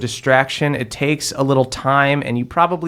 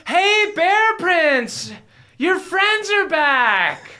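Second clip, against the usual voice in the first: very high, long-drawn sing-song call; dryad calling out; much slower than usual. Acted. Dryad calling to the Bear Prince, high drawn-out announcement. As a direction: holler up in a high, stretched dryad voice.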